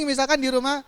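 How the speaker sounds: ordinary talk, nothing marked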